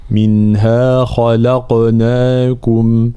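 منها خلقناكم with a heavy خ, a light ل and an echoing ق; slow recitation of منها خلقناكم; tajwid demonstration. Shown as a demonstration. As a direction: recite خلقناكم slowly, lips rounded on the final كم. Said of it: "هكذا".

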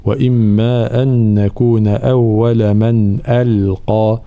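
slow measured tajweed recitation; تلاوة مجودة متأنية; Quran recitation. Shown as a demonstration. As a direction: recite it slowly with careful tajweed. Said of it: "الانتباه هنا إلى ترقيق الهمزة واللام ثم تفخيم القاف".

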